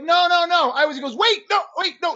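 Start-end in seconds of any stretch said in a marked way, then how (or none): 0.0-0.7 higher, louder rush of no's; retold insistent objection; urgent protest. Grandson protesting to his grandfather, urgent denial. 1.2-2.1 high-pitched retold cut-in; interrupting. Grandfather cutting off his grandson, interrupting. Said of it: "He interrupted me again".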